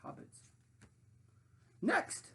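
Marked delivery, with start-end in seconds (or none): 1.8-2.3 high, loud crowing call; high triumphant crow; crowing sandwich. Acted. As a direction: crow it out like a bossy queen. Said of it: "she crowed".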